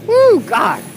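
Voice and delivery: high loud woo; lusty whoop. Real, not acted. Vlogger whoops loudly ogling a woman on the street.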